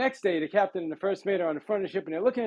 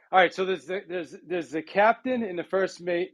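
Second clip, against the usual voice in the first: normal talking voice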